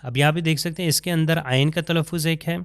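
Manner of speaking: usual speaking voice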